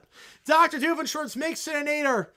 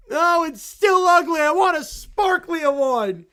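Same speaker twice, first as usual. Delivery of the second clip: put-on loud, slow kid's whine of complaint; whiny shout; 6 semitones higher, 8 dB louder, slower than usual. Kid shouts at Linus that the tree is ugly, slow whiny yell.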